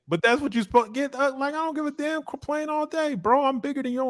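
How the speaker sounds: mock the smaller man like a bully who doesn't care; cocky bully. Voicing a fighter's bullying mindset.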